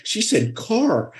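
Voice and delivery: high, drawn-out mocking kid; making fun. Kids mocking how a woman said car, high-pitched making fun. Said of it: "we were making fun of her".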